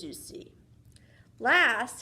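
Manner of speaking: usual speaking voice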